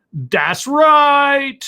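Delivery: high-pitched, drawn-out affirmation; put-on catchphrase. Donation thanks, catchphrase said twice.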